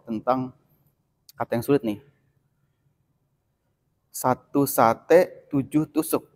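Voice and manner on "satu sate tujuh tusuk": "Satu sate, tujuh tusuk" drawn out slowly, each syllable clear; slow articulation demo. Shown as a demonstration. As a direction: spell out every sound of "satu sate" slowly. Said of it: "saya akan kasih contoh". (ucapkan pelan dan jelas)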